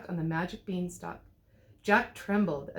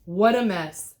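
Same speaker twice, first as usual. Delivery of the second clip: exasperated cry; 8 dB louder, slower than usual. A narrator's reading. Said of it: "cried the big bad wolf".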